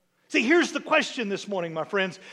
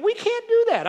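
high, louder whining protest; whiny doubt; 13 semitones higher, 6 dB louder than usual. Retold or imitated. Doubters' squeal when God asks too much, high and whiny. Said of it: "Our perspective is already going".

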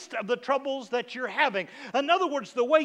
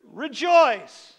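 booming proclamation; 3 semitones higher, 9 dB louder than usual. Acted. Preacher proclaiming a word.

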